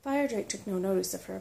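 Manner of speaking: ordinary delivery, nothing marked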